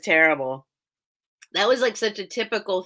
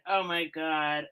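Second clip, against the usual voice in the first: normal talking voice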